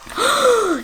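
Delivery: sharp high gasp of alarm. Acted. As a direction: gasp as if the bear is falling. Gasp at Corduroy's fall.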